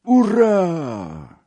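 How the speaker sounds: high, drawn-out hurrah; crowd's cheer. A narrator's reading. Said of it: "zawołała sfanatyzowana już sala".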